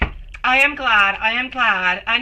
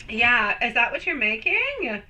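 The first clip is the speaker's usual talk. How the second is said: normal talking voice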